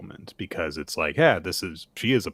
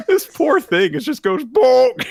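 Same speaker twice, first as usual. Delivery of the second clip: laughing; 9 dB louder than usual. Real, not acted. Laughing at the eaten devil.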